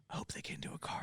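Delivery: fast whispered confession; whispering. Retold or imitated. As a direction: whisper it to a coworker. Confiding to the manager.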